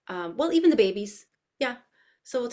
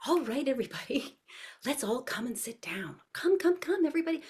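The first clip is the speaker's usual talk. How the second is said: hushed, airy coaxing of toddlers; high sing-song call to tiny children; animated teacher voice; 5 semitones higher, 5 dB quieter than usual. Shown as a demonstration. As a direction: gather the little ones with soft, lilting excitement. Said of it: "I'm speaking in my animated voice".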